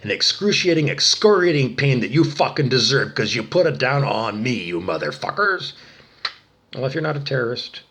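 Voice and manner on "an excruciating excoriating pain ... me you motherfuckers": loud, real rage with heavy stresses; furious damning. Real, not acted. Damning the tormentors to burn, loud and furious. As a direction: curse them at full voice.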